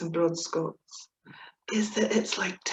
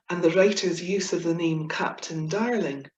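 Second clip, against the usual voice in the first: ordinary delivery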